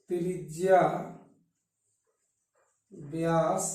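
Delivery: one word at a time, very long pauses; slow dictation. Shown as a demonstration. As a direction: spell out the rule word by word as you write. Dictates formula while writing it.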